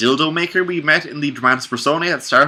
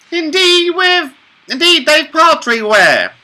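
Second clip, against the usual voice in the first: high character voice; 8 dB louder, slower than usual. Acted. Officina's line read in voice.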